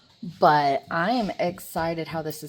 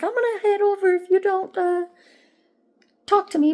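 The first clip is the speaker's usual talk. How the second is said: higher and louder, quoting her friend; mock friend voice; 12 semitones higher, 6 dB louder, faster than usual. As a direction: play the friend's pushy text, pitched up. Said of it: "She's like".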